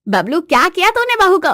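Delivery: scolding aunt. Acted. Neighbour aunt scolds husband.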